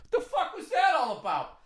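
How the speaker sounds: high, laughing mock outrage; mock reaction of a guy who just got punched; mock baffled guy.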